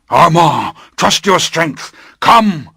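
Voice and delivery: high, loud, commanding shout; urgent call. Acted. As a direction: urge him on from the stairway top. Master calls back to Armand.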